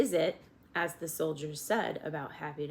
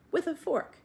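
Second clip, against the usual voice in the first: higher voiced soldier; lightly voiced soldier adding a detail; soldier suggesting; 11 semitones higher than usual. A narrator's reading. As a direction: soldier finishing his offer, higher. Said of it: "suggested the soldier".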